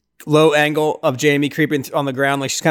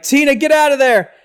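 high, loud mock yell; kids shouting; 10 semitones higher, 5 dB louder than usual. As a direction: yell a warning at her. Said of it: "they're like".

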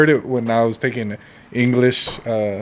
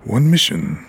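low, slow movie trailer announcer; 5 semitones lower, slower than usual. Shown as a demonstration. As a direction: epic coming-attraction voiceover, pitched down with dramatic pauses. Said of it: "Do the one man".

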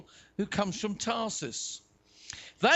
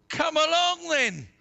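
loud, high beckoning call; hearty call; 9 semitones higher, 6 dB louder than usual. A narrator's reading. Jesus inviting Peter onto the water, calling out. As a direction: call him over heartily.

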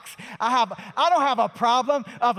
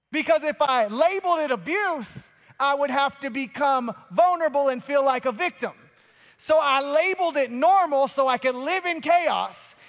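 raised, loud, driving sermon voice; preaching at full force; 4 semitones higher, 4 dB louder than usual. Acted. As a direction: preach it hard to the congregation.

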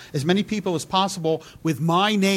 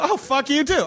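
much higher, delighted mock insult; gleeful mock; 8 semitones higher than usual. Tina Fey mocking an internet troll in her speech. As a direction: relish it like a prize speech. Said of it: "and to this person".

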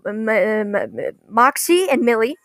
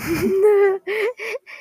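high, rising giggle; helpless high-pitched laugh; 9 semitones higher than usual. Real, not acted. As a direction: break into a real giggle. Laughs while retelling the scene.

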